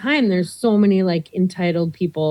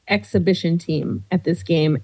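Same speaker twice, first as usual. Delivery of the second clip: normal talking voice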